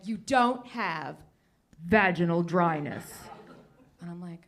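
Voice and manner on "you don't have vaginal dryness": slow doctor verdict. Retold or imitated. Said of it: "she's like".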